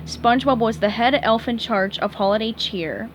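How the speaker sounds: plain reading voice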